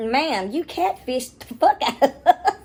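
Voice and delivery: high, slow, laughing disbelief; amused outrage. Retold or imitated. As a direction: say it through laughter. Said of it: "I'm like".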